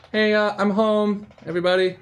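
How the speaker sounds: high, casual call into the house. Acted. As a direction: announce yourself from the door, trying to seem fine. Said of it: "just call out".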